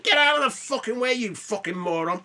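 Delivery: hammed-up outraged pensioner; angry old man. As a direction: chase him off the course with abuse. Old golfers yelling at him.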